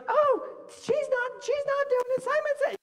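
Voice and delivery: put-on high, whiny child tattling on a playmate; tattletale kid voice. Kid tattling on a classmate during Simon Says, high and whiny. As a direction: squeal it like a kid telling on someone. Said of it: "And you're like".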